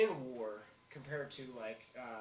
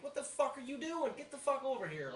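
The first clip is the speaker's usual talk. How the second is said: much higher, pushed yell of a class leader; mock scream; 11 semitones higher than usual. Class leader yelling at his underlings, a mock high scream. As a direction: bark at the underlings, high and sharp. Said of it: "I would scream at 'em".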